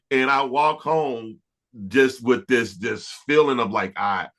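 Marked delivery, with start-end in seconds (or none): none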